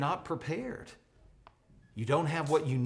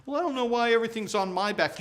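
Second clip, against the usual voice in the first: whiny player; 8 semitones higher, 4 dB louder, faster than usual. Pretend.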